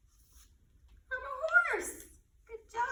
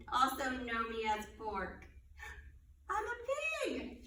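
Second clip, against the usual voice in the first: put-on riddle read aloud for little kids; reading to children; 3 dB louder than usual. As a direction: read it like storytime. Reads animal riddles to children.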